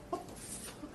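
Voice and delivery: whispered, pretend shock; mock stunned whisper. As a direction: whisper it as if aghast. Imagined waking beside frog head.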